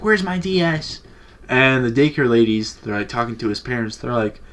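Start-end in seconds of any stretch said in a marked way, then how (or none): 0.0-1.0 higher-pitched mock panic; mock freak-out. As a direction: imitate a kid freaking out, pitch raised. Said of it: "freaking out".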